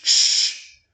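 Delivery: a loud voiceless "sh" hiss; sh sound demo. Shown as a demonstration. Teacher shows the Hebrew shin sound to students. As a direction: hold a strong sh. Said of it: "com o som de sh".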